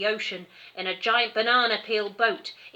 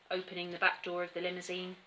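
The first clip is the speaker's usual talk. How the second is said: ordinary delivery, nothing marked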